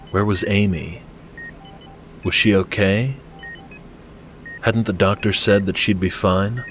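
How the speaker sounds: ordinary delivery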